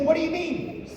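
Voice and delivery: very high, incredulous mother; mock a mother's disbelieving question; high-pitched puzzled mom impression; baffled mom. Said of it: "She said".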